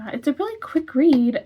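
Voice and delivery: normal talking voice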